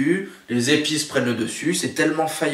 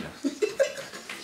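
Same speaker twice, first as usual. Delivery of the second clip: high strained giggle; 14 semitones higher, 8 dB quieter than usual. Real, not acted. Struggling to open the bottle.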